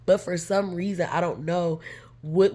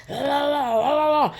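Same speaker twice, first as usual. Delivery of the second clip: high, loud babbling la la la; mock rant; 9 semitones higher, 5 dB louder than usual. Mocking best friend ranting, high nonsense babble. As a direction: parody her ranting on and on. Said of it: "She's just flipping out".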